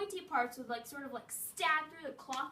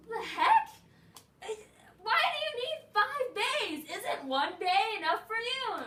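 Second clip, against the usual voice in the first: loud high disbelieving squeal; loud, high, disbelieving outrage; incredulous; 8 semitones higher, 8 dB louder than usual. Retold or imitated. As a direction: react in shocked disbelief. Incredulous at five boyfriends.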